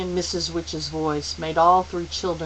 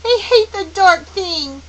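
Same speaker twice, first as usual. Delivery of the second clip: put-on loud, high child's protest; fierce, high-pitched outburst; passionate cry; 13 semitones higher, 10 dB louder than usual. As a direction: fling it out passionately. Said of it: "Charles Wallace cried passionately".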